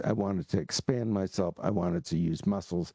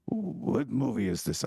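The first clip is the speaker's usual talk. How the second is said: lightly voiced old monk, high and slow; a hint of a puzzled old monk, drawn out; mild mock monk at the door, asking what movie; elderly monk asking; slower than usual. Monk asks at monastery door.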